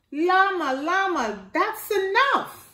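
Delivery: lightly voiced mother saying enough now; stern mama.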